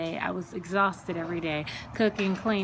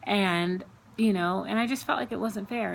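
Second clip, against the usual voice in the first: usual speaking voice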